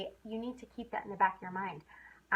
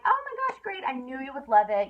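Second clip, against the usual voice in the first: a much higher, louder oh my gosh; thrilled seller; 4 semitones higher, 7 dB louder than usual. Retold or imitated. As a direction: gush at the happy customer. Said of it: "then I'm like".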